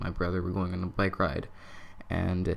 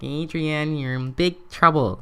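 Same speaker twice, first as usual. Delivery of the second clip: gleeful mocking taunt, a bit louder; teasing little brother; 7 semitones higher, 7 dB louder than usual. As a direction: gloat like a kid brother. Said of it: "just laughing at me".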